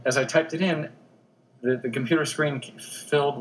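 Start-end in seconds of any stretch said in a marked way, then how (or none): none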